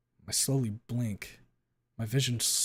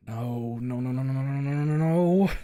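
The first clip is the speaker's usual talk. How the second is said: building denial; 2 semitones higher, 6 dB louder than usual. Acted. Narrator watching a friend shot, building desperate denial. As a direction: build the no's into a panicked cry.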